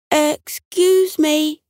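high, drawn-out polite call. A narrator's reading. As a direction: call up slowly to someone in a tree. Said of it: "Buck called up".

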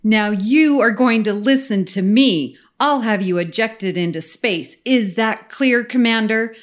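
imitating a forceful Romulan commander; stern commanding officer. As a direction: issue a firm, emphatic threat to a subordinate. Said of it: "sternest possible voice".

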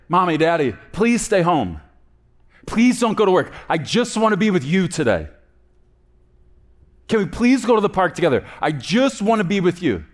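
put-on high, insistent child begging; pleading child. Child begging Mommy and Daddy to stay home, pleading. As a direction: plead like a kid who wants a parent home. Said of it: "you say to your children".

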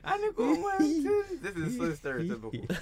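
loud laughing outrage. Real, not acted. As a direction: howl it through laughter. Laughing at the meme.

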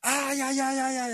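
high, drawn-out howl of pain; mock cry of pain. Retelling a teammate's injury, mock pained cries. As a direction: act out someone yelling in pain. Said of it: "así gritando".

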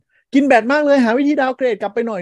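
high, loud imitation of whining users; exaggerated high-pitched complaining; mock outcry. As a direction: make a hammed-up fuss like a panicking user. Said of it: "โวยวายตลอดเวลา".

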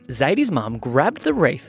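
ordinary delivery

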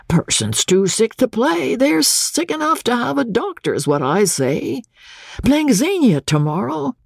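high-pitched grumbling showman; Doc fretting. Acted. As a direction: complain insistently in a folksy, high voice.

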